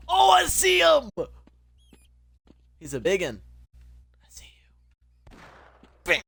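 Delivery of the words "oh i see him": much higher, loud, drawn-out shout; full-voiced shriek of recognition; shrieked repeat. Real, not acted. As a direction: yell it out the second time, slowed and stretched.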